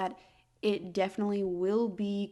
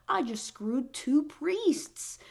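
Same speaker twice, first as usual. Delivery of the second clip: mock shock; 4 semitones higher, much slower than usual. Emily voiced shocked.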